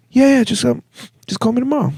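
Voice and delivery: high, mock-tearful reply; put-on weepy friend voice; crying. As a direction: imitate her sniffly giving in, pitched well up. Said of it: "she was crying".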